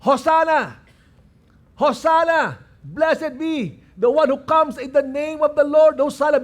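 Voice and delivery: high, loud jubilant cheer; crowd crying out. Acted. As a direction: cry it out like a welcoming crowd. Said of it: "They were shouting, crying out".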